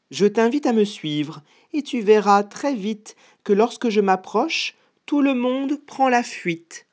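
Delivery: high, confident mouse voice; boastful mouse. Acted. Mouse inviting the gruffalo to follow her, high and boastful.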